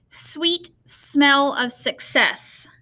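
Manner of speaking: very slow, each S in Sweet smell of success drawn out; slow example phrase. Shown as a demonstration. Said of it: "because the S".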